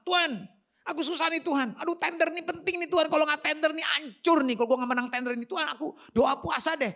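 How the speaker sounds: high, urgent pleading prayer; desperate pleading. Acted. A man begging God to win a tender, desperate pleading.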